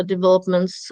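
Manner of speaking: normal talking voice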